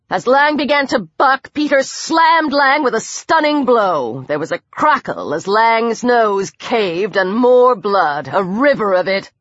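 ordinary delivery, nothing marked

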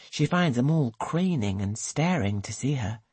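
ordinary delivery

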